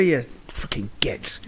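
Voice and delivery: under-the-breath whispered insult; whispered aside. Retold or imitated. Dad whispers a curse at his wandering son. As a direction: hiss the curse so the kid cannot hear. Muttered insult after yelling.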